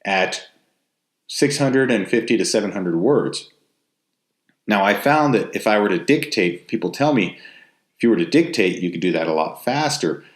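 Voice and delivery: ordinary talk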